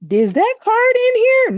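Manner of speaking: sudden "wait, is it here?"; excited surprise at a card; surprised exclamation. Real, not acted. Searching the deck, surprised.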